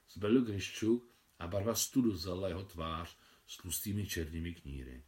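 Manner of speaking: plain reading voice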